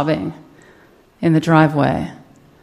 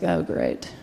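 sarcastic dread; 12 semitones lower, 9 dB quieter than usual. Retold or imitated. Dreading the talk with Rick.

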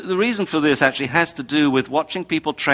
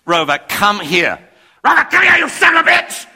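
builds from firm call to a much higher, louder furious scream; screaming at the dog; 7 semitones higher, 6 dB louder than usual. Retold or imitated. Owner in the park screaming at the dog to come. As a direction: lose it completely by the end. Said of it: "screaming like that".

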